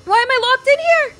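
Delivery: high, loud panicked yell; startled loud cry of panic; alarmed shout. Real, not acted. Suddenly trapped in game room.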